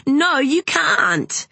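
high, drawn-out outraged protest; indignant cry. A narrator's reading. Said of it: "cried Madeline".

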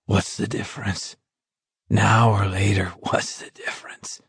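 weary, trailing into a whisper; fading whisper. A narrator's reading. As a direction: let it die to breath. Dying man's weary repetition.

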